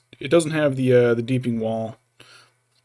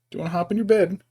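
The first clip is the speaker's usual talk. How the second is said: coaxing pet talk; 6 semitones higher, faster than usual. Real, not acted. Coaxing the cat into bed.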